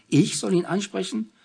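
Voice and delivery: can't believe she's being asked; incredulous. A narrator's reading. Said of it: "fragte Anna ungläubig".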